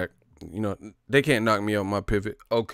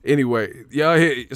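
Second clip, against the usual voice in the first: usual speaking voice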